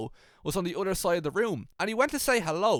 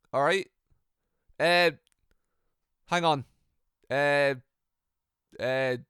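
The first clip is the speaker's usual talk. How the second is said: normal talking voice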